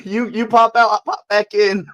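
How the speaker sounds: normal talking voice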